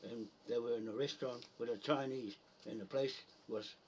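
usual speaking voice

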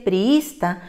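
priista, very slow, stress on the second i; very slow demo. Shown as a demonstration. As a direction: draw out priista, leaning on the middle.

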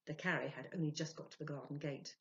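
ordinary delivery, nothing marked

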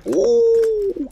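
long, very high "ooh"; sudden falsetto hoot, held long; high-pitched whoop. Real, not acted. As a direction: whoop a loud, soaring "uuuh". Excited reaction to the game.

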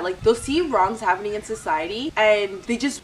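ordinary talk

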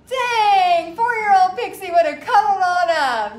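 loud, high, playful exclamation; mock indignant outcry. Mom retelling her reaction to her teen pulling away, loud mock outcry. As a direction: retell it as a big hammed-up protest.

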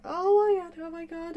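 high squeal, loud then easing off; reliving happy shock in a squeal; shocked delight. Retold or imitated. YouTuber retelling her shock at being featured, squealing. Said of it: "I was just in shock".

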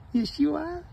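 high pet talk. Real, not acted. Owner praising her cat in high baby talk. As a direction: coo it to the cat.

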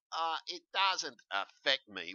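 high, drawn-out dismissive mimicry; mock ignorant. Warning viewers by mimicking those sitting back, mock ignorant. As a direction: mimic someone ignorantly shrugging it off, slowly. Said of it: "sitting back being fucking ignorant".

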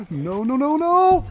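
rising fast to a high, loud yell; repeated cry climbing into panic; panicked shout. Real, not acted. Player sees danger coming at them, panicked. Danger closing in during game.

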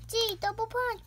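excited move call. Pretend. Calls move.

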